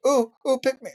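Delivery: high, squealing pick-me plea; overeager. Retold or imitated. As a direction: bounce like a kid desperate to be chosen. Eager kid begging to be picked.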